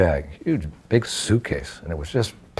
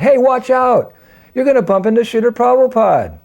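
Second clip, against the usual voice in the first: sudden high, loud warning; warning shout; 7 dB louder than usual. Retold or imitated. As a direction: yell to stop him bumping into Prabhupada. Servant warns him off Prabhupada.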